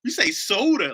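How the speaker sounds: hammed-up local scolding an outsider; mock LA local. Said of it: "they'll beat the shit out of you for saying pop".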